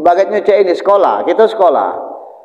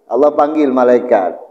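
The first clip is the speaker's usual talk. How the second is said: ordinary talk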